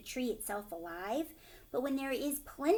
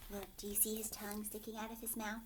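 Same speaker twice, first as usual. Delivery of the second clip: usual speaking voice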